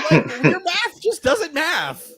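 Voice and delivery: laughing. Real, not acted. Mocking friend's math, laughing.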